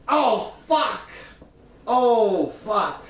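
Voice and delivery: loud high pained groan; stretching groan. Real, not acted. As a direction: groan as joints crack. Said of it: "That loud crack or the pop as I'm stretching".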